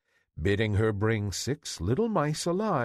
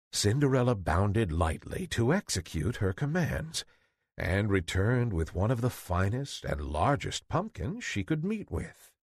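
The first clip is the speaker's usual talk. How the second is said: ordinary delivery, nothing marked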